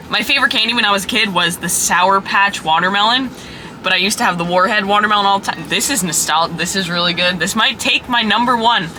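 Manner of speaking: normal talking voice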